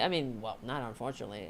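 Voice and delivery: ordinary talk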